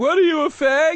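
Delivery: high, loud mock accusation; sneering mock impression of the bit; mocking quote. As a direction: fling it back in a shrill mock voice. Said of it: "You just did that bit at me".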